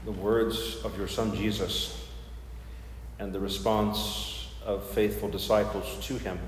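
soft, reverent prayer voice. Acted. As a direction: pray it quietly and humbly. Leads the opening prayer.

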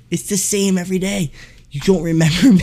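high-pitched, hammed-up indignation; imitated yelling customer. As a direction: complain in a high put-on voice, outraged. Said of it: "He's yelling at the guy".

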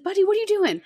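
high, loud call across the playground; retold call to her digging son. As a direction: call out to the kid, surprised.

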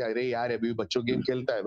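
fast dismissive Hindi taunt; north indian accent. Retold or imitated. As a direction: rattle it off like a scoffing relative. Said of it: "people will say".

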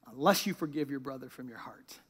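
low, very soft and grave; hushed solemn verse. A narrator's reading. Preacher landing the verse's condition on the congregation, hushed. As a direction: let "heart" fall away quietly. Verse contrasted with the head version.

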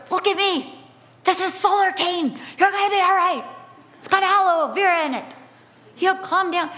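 calm her down, steady and kind; reassuring mother. Retold or imitated. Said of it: "just grabbing her going".

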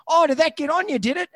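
surprised owner. Retold or imitated. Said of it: "He goes".

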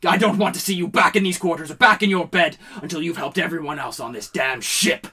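loud, fast shouted commands; raging orders. Acted. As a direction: drive the boy out in a rage. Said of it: "eyes turn red with rage".

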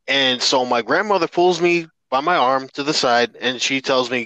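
ordinary talk

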